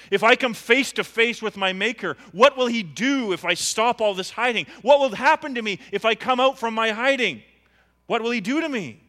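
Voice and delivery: loud, high, pleading questions; preaching at full force. Acted. Preacher voicing a sinner afraid to face God, loud. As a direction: cry out the sinner's fear.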